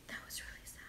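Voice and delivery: breathless whisper, no voice at all. Real, not acted. To viewers after the sad letter, whispering it. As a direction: let it fall to a bare whisper.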